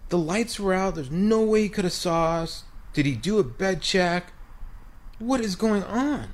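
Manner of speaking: high-pitched, bewildered questioning; baffled disbelief. Retold or imitated. Players pondering in the bathroom after being caught, baffled. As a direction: puzzle it out in a high, incredulous voice.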